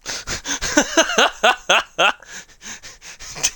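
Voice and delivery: high, loud burst of laughter; helpless loud laughing fit at the game. Real, not acted. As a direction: burst out in a long, loud cackle. Laughs at the game's story.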